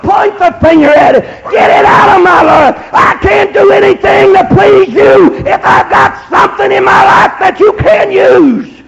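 high, forceful plea to God; crying out. Retold or imitated. Preacher retelling his surrender to God, crying out. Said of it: "I was crying out".

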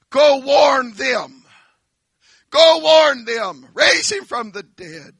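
rich man crying out. Acted. Rich man in hell begging Abraham to warn his brothers. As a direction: beg with everything. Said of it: "rich man pleading".

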